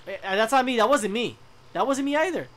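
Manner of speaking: loud, quick protest; loud denial. Real, not acted. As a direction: insist loudly it wasn't you.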